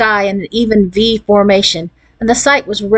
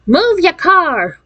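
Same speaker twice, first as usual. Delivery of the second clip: enraged shout; 10 semitones higher, much slower than usual. A narrator's reading. New Yorker yelling at a blocking car, enraged shout. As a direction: read out an enraged street shout, pitched way up. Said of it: "an enraged shout of".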